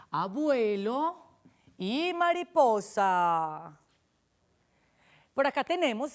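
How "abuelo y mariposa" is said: "abuelo y mariposa" slow, every syllable stretched; drawn-out words. Shown as a demonstration. Teacher joining syllables for children, "abuelo y mariposa" drawn out. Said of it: "miren cómo se dice".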